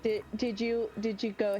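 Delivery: normal talking voice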